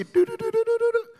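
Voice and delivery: very high, rapid "do-do-do" trill; imitated tuning noise. Preacher mimicking mama tuning in to listen, imitated tuning noise.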